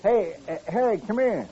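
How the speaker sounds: high call across the bar. Acted. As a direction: call him over from across the room. Calling Harry over from bar.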